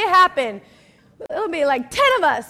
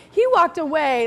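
usual speaking voice

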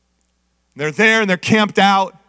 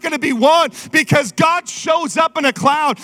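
full-force preaching; 5 semitones higher than usual. Acted. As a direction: preach it hard to the church.

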